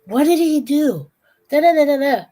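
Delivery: high, loud, excited babbling; SpongeBob voice. Retold or imitated. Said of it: "SpongeBob was like".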